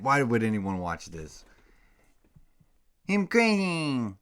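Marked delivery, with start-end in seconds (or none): 3.1-4.1 put-on high, slow, loud squeaky baby talk; doggy baby talk. Owner to his puppy Bogey, in high slow baby talk.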